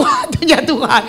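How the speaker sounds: God's timing said through a laugh; laughing while reminding the congregation of God's timing. Real, not acted. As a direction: chuckle it out to the congregation. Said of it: "betul nggak".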